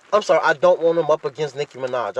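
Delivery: ordinary talk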